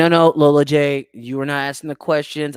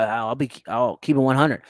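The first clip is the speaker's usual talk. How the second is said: usual speaking voice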